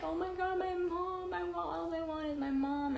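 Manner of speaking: high-pitched pleading whine; hammed-up wail held high all through; mock wailing. Woman in labour wanting her mom, high mock wail.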